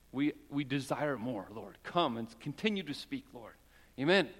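prayer. Real, not acted. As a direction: speak earnestly and intimately to the Lord. Join me in prayer for a moment.

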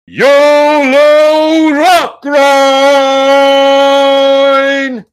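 booming announcer. Acted. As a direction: call the name across the arena. Announcer calls next hero.

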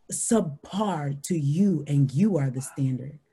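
slow, indignant retort with hard emphasis; retold outrage, measured and forceful; ticked off. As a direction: let each accusation land deliberately. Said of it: "it ticked off in me".

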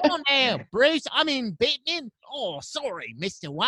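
over-the-top Aussie Alfred egging Batman on; Australian butler. Acted. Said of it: "hit me with more Australian butler".

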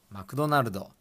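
Ma-ku-do-na-ru-do, every syllable even; Japanese pronunciation. Shown as a demonstration. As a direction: say マクドナルド with Japanese vowels after each consonant. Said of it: "Mac or マクド".

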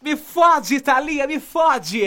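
put-on loud lusty Rio girl cries; Rio accent. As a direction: yell it like a carioca in bed. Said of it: "Aquela carioca".

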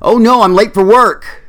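jolted awake and late; panicked. Acted. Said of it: "a sleepy clerical worker said".

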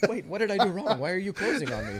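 squeaky panicked voice, laughing; high laughing mock panic. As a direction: play a confused worshipper, half laughing. Mock confused prayer, laughing.

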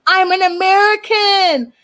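high-pitched shouted parody of a proud American rant; mock screaming. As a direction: screech it in his face like the wife. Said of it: "She starts screaming in his face".

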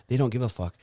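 ordinary talk

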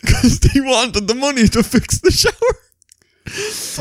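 laughing and crying with laughter, slow. Real, not acted. Joe cracking up telling his friend about the shower. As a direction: laugh your way through it.

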